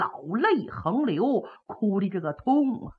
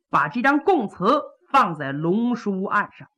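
usual speaking voice

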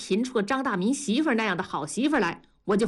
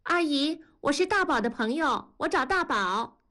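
put-on higher-pitched girl at the door; girlish, raised pitch; young girl's voice; 7 semitones higher than usual. A narrator's reading. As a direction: voice a girl politely asking for someone. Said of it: "一个女孩子的声音说".

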